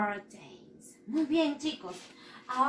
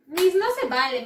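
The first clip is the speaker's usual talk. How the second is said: higher, louder mock child complaint; protesting pupil; 6 semitones higher, 7 dB louder than usual. Pupil protesting to Miss it is unfair, mock indignant. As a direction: whine it like a cheated pupil.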